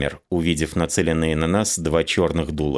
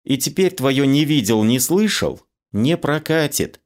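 mildly angry, higher-pitched retort; angry woman; 8 semitones higher than usual. A narrator's reading. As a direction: put him down in a higher voice, lightly cross. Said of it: "она сказала зло". (слегка зло, высоким женским голосом)

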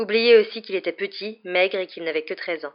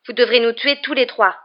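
loud, raised defiant insistence; standing firm against a threat; insistent; 5 semitones higher, 8 dB louder than usual. A narrator's reading. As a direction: press it home, higher and louder. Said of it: "insista Ron".

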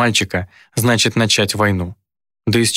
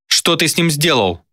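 angry demand; 6 semitones higher than usual. Acted. Demon threatens the uncle.

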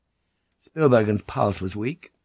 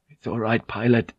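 ordinary delivery

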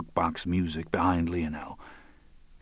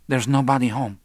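higher, louder reply; lightly voiced female character; 6 semitones higher, 5 dB louder than usual. A narrator's reading. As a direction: answer in a slightly raised woman's voice. Marta's voice in dialogue.

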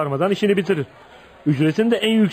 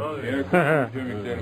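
short laugh; 4 semitones lower, 5 dB quieter than usual. Acted. Colin laughs after threatening workers.

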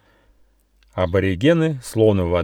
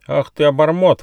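higher, louder offended squirrel; cross, raised-voice retort; indignant outburst; 7 semitones higher, 6 dB louder than usual. A narrator's reading. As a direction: burst out in indignation. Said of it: "возмутился бельчонок Рыж".

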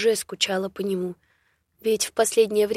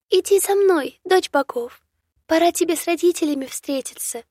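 high, loud birdlike chirp; chirping bird; 7 semitones higher, 3 dB louder than usual. A narrator's reading. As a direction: chirp eagerly like a little bird. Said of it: "прочирикала пернатая". (чирикающая птичка)

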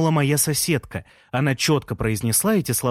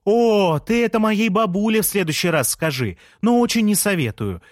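higher, louder shout; shouting back; 9 semitones higher, 4 dB louder than usual. A narrator's reading. Leya shouting back at Jean. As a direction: yell back from the stairs. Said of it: "крикнула в ответ Лея".

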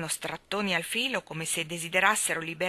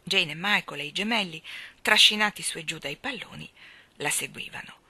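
plain reading voice

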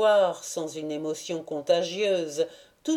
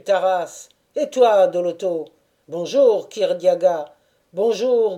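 louder, lively crowd greetings; exclaiming greetings; 5 dB louder than usual. A narrator's reading. Old Cossacks hailing each other across the square, louder. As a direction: call out to old friends. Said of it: "les exclamations suivantes".

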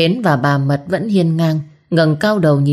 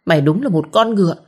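fast, contemptuous jab; fast scornful insult to her rival; quick spat insult; faster than usual. A narrator's reading. Diệu insulting Hến.

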